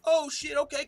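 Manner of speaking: high startled gasp of a curse; sudden high surprised oh shit; shocked. Retold or imitated. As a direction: caught off guard, voice jumps up. Shocked reaction quote.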